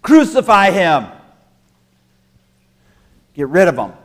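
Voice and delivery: loud, hard demand to kill; angry mob demand. Acted. The Friday crowd demanding Jesus' death, angry and loud.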